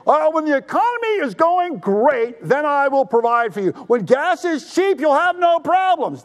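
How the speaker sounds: high, slow and sing-song, mocking; sarcastic high mock quote; mock false promise. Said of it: "He's not saying".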